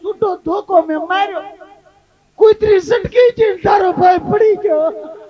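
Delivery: mock-excited village wife; excited telling. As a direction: blurt out the mishap half laughing.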